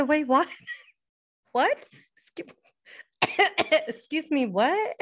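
can't believe her ears, wait, what; incredulous disbelief. Retold or imitated. Daughter hearing dad keeps it at seventy-eight, stunned. Shocked at dad's thermostat setting.

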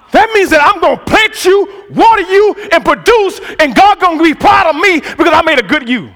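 loud, high, rising sermon cadence; preaching at full force. Acted. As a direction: preach it with climbing intensity.

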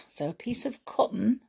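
breathy hushed telling of something tiny; soft whisper. Acted. To her listeners, about the fairy fly, a hush. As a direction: whisper it like a secret.

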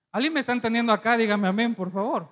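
loud, quick appeal to the congregation; loud pulpit call; calling for amen. Real, not acted. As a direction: rouse the crowd at full preacher volume.

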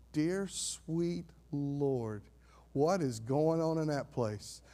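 slow, drawn-out amazed onlooker; hushed astonishment. Acted. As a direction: stretch it out softly in awe. Quoting astonished people walking out.

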